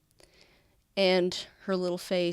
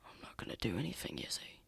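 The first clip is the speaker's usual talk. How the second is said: low, very soft whisper; barely audible low taunt; whispered villain reply; 7 semitones lower, 14 dB quieter, much faster than usual. Acted. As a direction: answer the captive under your breath. Assassin answers captive in whisper.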